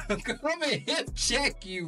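ordinary delivery

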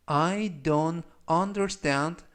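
Don't be worried about that, word by word, very slowly; very slow model reading. Shown as a demonstration. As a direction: read it out very slowly for students to copy. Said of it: "역시 천천히 세 번씩 읽어드리고요".

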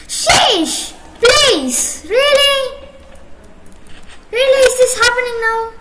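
exasperated outbursts; loud disbelief. Real, not acted. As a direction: throw up your hands, loud and fed up. Annoyed by noise during game.

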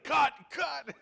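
high-pitched mock "cut" call with laughter; playfully imitating someone stopping the take; imitated call to cut. Mimics someone calling cut.

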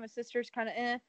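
usual speaking voice

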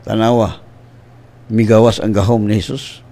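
ordinary talk, nothing marked

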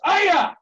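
loud, high karate cry; explosive "aya" battle cry; martial arts yell. Shown as a demonstration. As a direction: let out a piercing kiai. Martial arts call to ready stance.

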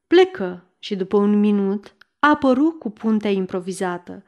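usual speaking voice

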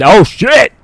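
loud alarmed yell; panicked shout. Real, not acted. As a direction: scream in sudden alarm. Sudden danger in game.

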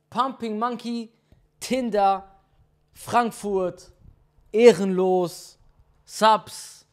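laute, aufgedrehte Ansagerstimme; Stream-Intro-Ansage. Acted. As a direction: pump die Zuschauer hoch. (Streamer begrüßt seine Zuschauer, laut und aufgedreht)

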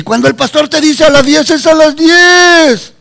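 high scolding call building to a shout; mock scolding. Preacher scolding churchgoers who come late, mock outrage. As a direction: climb high and stretch the final word loud.

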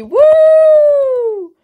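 loud high whoop of joy; excited cheer. Real, not acted. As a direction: cheer it out big. Big channel announcement cheer.

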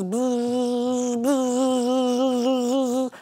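slow imitated buzzing; drawn-out bee buzz. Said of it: "he goes".

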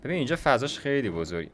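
ordinary delivery

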